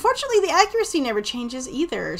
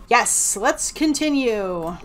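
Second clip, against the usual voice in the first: ordinary delivery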